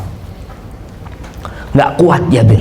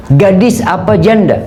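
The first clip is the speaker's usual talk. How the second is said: Prophet's question, raised; 6 semitones higher than usual. Acted. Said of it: "Ditanya Jabir".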